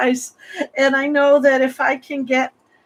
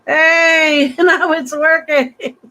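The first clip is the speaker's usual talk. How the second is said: laughing and near tears, voice jumping much higher; overjoyed relief; 6 semitones higher, much slower than usual. Real, not acted. Host relieved the broken stream finally works, laughing through tears. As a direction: burst out in relieved, teary laughter.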